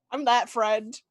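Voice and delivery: normal talking voice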